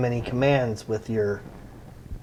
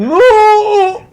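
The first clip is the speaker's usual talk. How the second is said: shouted no; 11 dB louder than usual. Acted. GM shouts in character.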